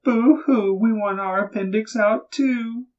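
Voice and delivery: put-on high, loud, whiny little-girl boo-hoo; mock crying little girls. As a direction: wail it like jealous little girls pretending to cry. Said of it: "all the little girls cried".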